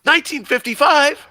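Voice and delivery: shocked disbelief. Acted. Marty shocked at the year.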